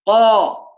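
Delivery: a ringing voiced قا; strong qaf. Shown as a demonstration. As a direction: hit قا firmly with voice. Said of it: "القاف قوية مجهورة".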